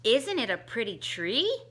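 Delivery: plain reading voice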